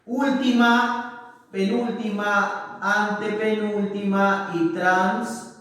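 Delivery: slow, drawn-out list of positions; counting off syllables. Shown as a demonstration. Teacher showing syllable positions to the class, counting them off slowly. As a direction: stretch each term out slowly. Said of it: "Contando de izquierda, perdón, de derecha a izquierda".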